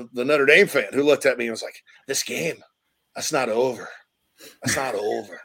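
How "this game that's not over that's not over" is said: quiet, understated Irish accent; soft imitation of an Irish sports fan. Shown as a demonstration. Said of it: "Irish go at you kinda like that".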